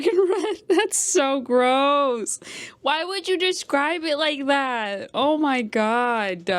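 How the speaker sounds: high, drawn-out revolted groaning; appalled, over-dramatic disgust. Real, not acted. Reacting in disgust to book's wording.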